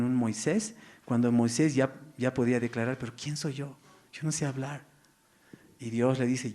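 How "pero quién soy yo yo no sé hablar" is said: soft, small, self-doubting question; hushed Moses doubting himself; quiet humble objection. Acted.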